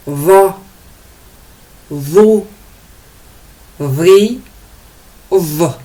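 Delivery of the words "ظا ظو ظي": ظا, ظو, ظي said with the tongue tip out between the teeth, louder. Shown as a demonstration. Teacher modelling ظا syllables for learners, louder. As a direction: model each sound clearly with the tongue out. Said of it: "On sort un peu notre langue pour le prononcer".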